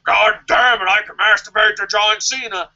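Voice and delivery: lightly voiced blustering boss; imitating Vince McMahon. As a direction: ham up the old boss. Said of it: "Vince is like".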